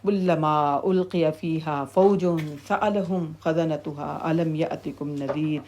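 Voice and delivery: slow, soft, drawn-out Arabic recitation; Quran recitation. Real, not acted. Teacher reciting a verse about Hell's keepers, slow soft recitation. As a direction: recite the verse softly, lingering on long vowels.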